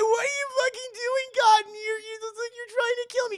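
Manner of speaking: high, loud crying shriek; hammed-up horror heroine panic; panicked scream. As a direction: scream it in terror at the door. Victim confronts boyfriend in movie.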